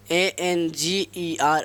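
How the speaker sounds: slow dictation of A N G E R; spelled out letter by letter. Shown as a demonstration. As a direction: spell A N G E R slowly, each letter apart. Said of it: "anger". (धीरे-धीरे अक्षर-अक्षर बोलना)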